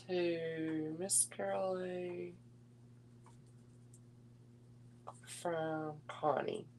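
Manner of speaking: very slow, soft, drawn out word by word; slow dictation while writing. Real, not acted. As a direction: say it slowly as if writing each word on the card. Writing the note while saying it.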